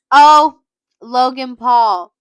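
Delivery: drawn-out loud cry of the name; loud slow exclamation. Real, not acted. Verdict on a celebrity.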